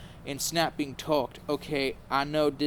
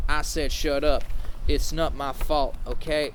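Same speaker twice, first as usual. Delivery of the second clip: plain reading voice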